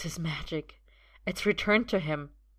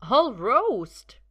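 protesting; 6 semitones higher, 9 dB louder than usual. A narrator's reading. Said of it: "Merlin protests".

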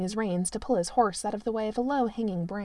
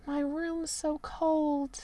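higher, slow, woeful servant; hammed-up tragic Merlin; 6 semitones higher, 4 dB quieter, slower than usual. A narrator's reading. Merlin moans to the prince about his cold room. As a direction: pile on the self-pity, slowly.

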